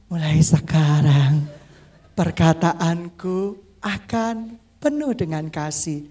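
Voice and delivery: low, slow, measured promise; deep unhurried pledge of kind words; sincere loving vow. Shown as a demonstration. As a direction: draw each phrase out slowly and sincerely. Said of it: "Dengan tulus, tanpa intonasi".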